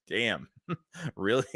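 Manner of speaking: low, soft chuckle through the words; amused laughing. Real, not acted. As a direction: laugh quietly in disbelief between words. Amused reaction to a chat message.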